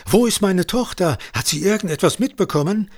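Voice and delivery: high, anxious questioning; urgent, worried, pitched well up; alarmed outburst. A narrator's reading. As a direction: fire out the questions like a panicked father. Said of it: "stieß Johannes aus".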